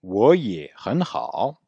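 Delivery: 我也很好 very slowly, full tones; drawn-out Mandarin teaching take; slow repeat model. Shown as a demonstration. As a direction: model it syllable by syllable for repetition. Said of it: "Répétez encore une fois".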